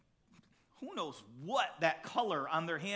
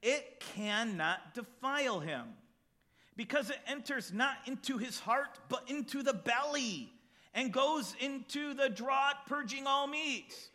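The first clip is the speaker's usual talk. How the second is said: solemn scripture reading; slower than usual. Acted. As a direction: read the verses with weight, letting the list sink in. Reads Mark chapter seven aloud.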